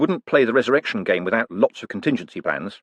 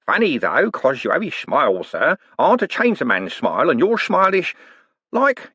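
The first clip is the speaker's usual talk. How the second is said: higher, wheedling rogue's voice with a knowing lilt; sly old conman; 6 semitones higher than usual. Acted. Old con man teasing Moist about his past, sly and wheedling. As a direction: needle him like a grinning old crook.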